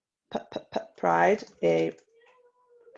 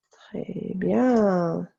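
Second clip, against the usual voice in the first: usual speaking voice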